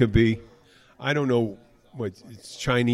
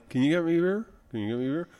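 mock mumbler trailing off softer; imitated mumble; 3 semitones higher, 5 dB quieter, much faster than usual. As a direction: mumble it through unmoving lips, fading. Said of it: "I was doing it to mumble".

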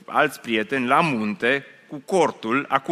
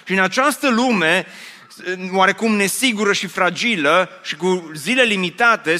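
usual speaking voice

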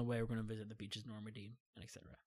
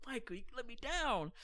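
mock scolding; 11 semitones higher than usual. Teasing friend for tearing up.

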